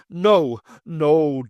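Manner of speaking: a burst of impotent fury; angry denial. Acted. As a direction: shout her down in rage. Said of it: "the rage welling up inside him".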